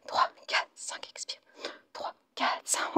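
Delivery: very soft, whispered exercise beats; whispered count. Real, not acted. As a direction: count on the breath. Counting breaths during the exercise.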